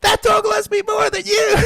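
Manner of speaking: high shouted taunt; mock drunk girlfriend shout. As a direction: yell it like a drunk woman showing off.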